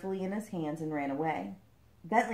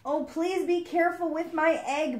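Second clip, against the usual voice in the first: higher pleading plea; 10 semitones higher, 4 dB louder than usual. A narrator's reading. Frog begging the boy to handle the egg gently, higher pleading. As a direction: beg from afar, worried. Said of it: "Bentley pleaded aloud".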